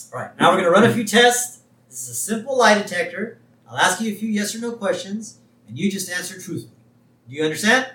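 high, lightly acted examiner voice; Scully. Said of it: "And so Scully says".

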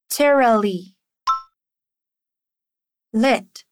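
ordinary delivery

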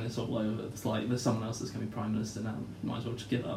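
low, soft retold bad news; quiet, resigned quote; hushed phone call. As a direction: murmur it like a grim call. Acting Malcolm's phone call.

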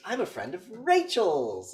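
high, loud, drawn-out mock introduction; hammed-up chummy villain; overfriendly stranger. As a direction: stretch it out with fake friendliness. Acts out villain introducing himself.

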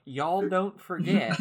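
slow mock Southern drawl; Southern accent. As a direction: read it in a hammy Southern accent. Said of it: "they write in their own dialect".

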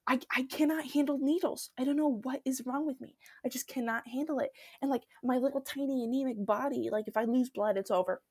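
normal talking voice

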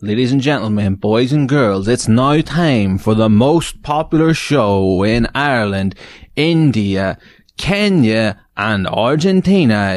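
booming game show host intro; grand entrance announcement. Acted.

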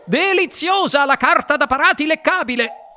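high, delighted exclamation; Wonka esclama. A narrator's reading. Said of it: "esclamò il signor Wonka sempre di corsa". (esclamazione acuta ed entusiasta)

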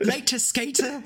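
put-on co-host parodies Steven's Oliver Twist accent, slow and high. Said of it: "an Oliver Twist accent".

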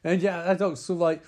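ordinary talk

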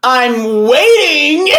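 loud, high, drawn-out singsong complaint; mock impatient customer. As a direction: stretch it out, demanding attention. Acting an impatient ungreeted customer.

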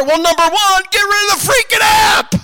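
high, very loud exasperated order; exasperated shout. Acted. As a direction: yell the obvious fix at her. Exasperated answer to the mother.

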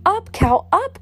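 high, loud urging; crying out. A narrator's reading. Grandude urging the flying cow, loud high cry. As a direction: urge the cow upward with a high call. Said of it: "Grandude cried".

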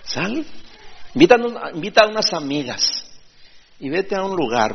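high, coaxing influencer voice; mock-cheerful urging to go out; imitating an influencer. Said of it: "le dice".

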